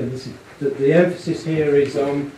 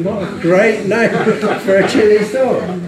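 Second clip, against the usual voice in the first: laughing; 7 semitones higher, 6 dB louder than usual. Real, not acted. Laughs at great name.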